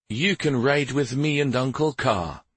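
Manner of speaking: plain reading voice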